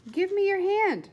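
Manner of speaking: insistent repeat. Acted. Flora repeats her plea to William, high and insistent.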